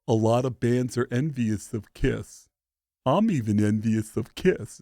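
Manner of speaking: lightly voiced, slow rock-star earnestness. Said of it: "[name] would say things like".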